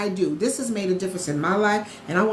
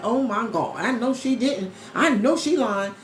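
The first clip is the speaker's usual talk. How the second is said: a hint of a shocked doubter; slow, drawn-out sassy disbelief; mock gasp of a viewer who thinks she's lying; disbelieving skeptic; 2 semitones higher than usual. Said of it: "some people are like".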